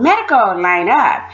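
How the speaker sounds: high first word, dropping on the order; mock call to the dorm. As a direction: imitate a supervisor's line-up call. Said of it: "and call".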